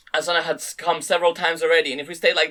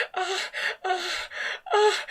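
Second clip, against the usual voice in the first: high moans; 4 dB quieter, much slower than usual. A narrator's reading. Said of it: "mixed in with the sound of arousal".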